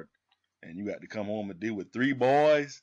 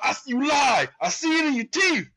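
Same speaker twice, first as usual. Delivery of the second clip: high, loud imitated shouting accusation; accusing dad shout; 12 semitones higher, 6 dB louder than usual. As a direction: yell it like a father who caught you lying. Dad accusing son of eating.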